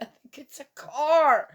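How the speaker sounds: high, loud holler with a laugh; retold calling out. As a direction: call back loudly and high, half laughing. Retold hollered exchange between rooms.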